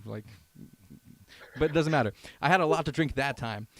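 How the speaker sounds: usual speaking voice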